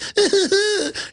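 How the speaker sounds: high, silly mock giggle. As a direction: giggle like a conned fool. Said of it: "they just giggle".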